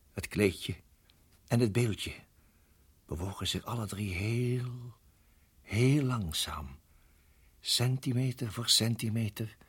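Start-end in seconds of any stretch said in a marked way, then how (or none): 3.1-9.6 very slow, stretching each word; slow suspense. Acted. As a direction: draw it out, heel langzaam. Spanning, slang kruipt langzaam. (heel langzaam en gespannen)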